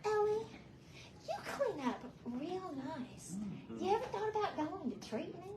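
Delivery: soft, careful imitation of a client; gentle suggestion. Client suggesting treatment to Ellie, gently. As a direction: float the question quietly.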